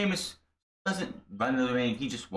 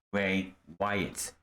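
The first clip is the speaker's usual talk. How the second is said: normal talking voice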